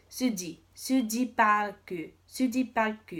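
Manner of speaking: Suji Park with a D-like j, sudi; Korean Suji. Shown as a demonstration. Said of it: "D mixed with a J".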